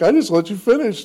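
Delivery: high-pitched imitated excuse; mock defensive. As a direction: plead innocence in a hammed-up high voice. Friend defends himself in retold exchange.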